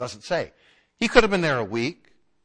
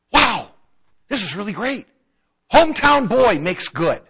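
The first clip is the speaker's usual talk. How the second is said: proud excitement; 5 semitones higher, 8 dB louder than usual. Retold or imitated. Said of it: "maybe they thought".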